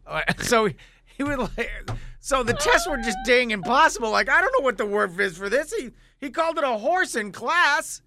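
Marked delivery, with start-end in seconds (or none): none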